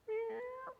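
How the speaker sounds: very high, soft meow; a tiny mew at the window; cat meow. Shown as a demonstration. Teller meowing the cat at the bathroom window. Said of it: "That's the noise I make".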